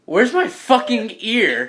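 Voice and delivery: high, drawn-out indignant question; slow, high-pitched exasperation; indignant demand. Acted. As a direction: demand it in comic outrage. Character demands missing ear.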